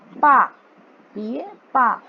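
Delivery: पा with a wide-open long "aa"; loud, model पा for learners; open-mouthed vowel. Shown as a demonstration. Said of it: "मुंह को खोलिए".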